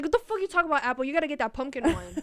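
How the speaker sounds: loud indignant; outraged at the apple choice; indignant shout. Retold or imitated. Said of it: "I'm like".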